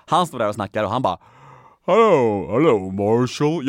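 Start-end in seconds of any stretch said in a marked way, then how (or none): 1.9-3.7 loud, slow, drawn-out TV character voice; Barney. Retold or imitated. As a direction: stretch out the greeting like a distorted sitcom voice. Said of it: "du vet Barney". (utdragen, förvrängd tv-röst)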